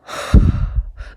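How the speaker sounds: loud, heavy exhale; exasperated sigh. Real, not acted. Drained on their own birthday, heavy exasperated sigh. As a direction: sigh it out hard, fed up.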